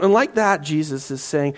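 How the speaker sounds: usual speaking voice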